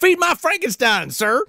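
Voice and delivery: high, laughing put-on voice; mock mad farmer. Joking mad farmer line.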